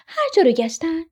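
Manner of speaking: plain reading voice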